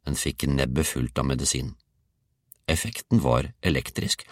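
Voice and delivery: ordinary delivery, nothing marked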